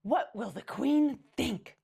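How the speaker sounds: loud, high, slow dismayed cry; appalled snob crying out; cried out. A narrator's reading. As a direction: wail it in horror. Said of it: "Missus McSnoot cried".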